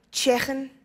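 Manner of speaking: Liverpool Scouse, throaty ch in "Chicken"; Scouse accent. Shown as a demonstration. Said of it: "the Scouse accent".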